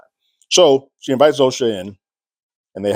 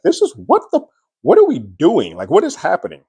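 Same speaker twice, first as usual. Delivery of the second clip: retold upset outburst, voice jumping much higher; replaying her flustered disbelief, pitched high; 8 semitones higher than usual. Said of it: "You can tell that she's upset".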